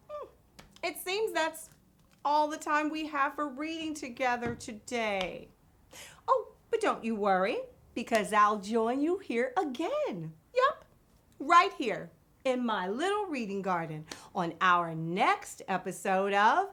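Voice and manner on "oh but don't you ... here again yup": usual speaking voice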